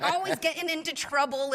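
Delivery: high, tickled retelling; mock a fond, amused complaint up high; imitating amused mom. Mom recalls her son's mischief, imitated amusement. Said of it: "She starts cracking up laughing".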